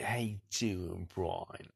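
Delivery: slow, lightly voiced old Cockney fella; imitating Cockney Dave. Driver copying his old Cockney colleague's greeting, slow. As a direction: squint and drawl it out like the old fella. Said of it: "he's talk like that".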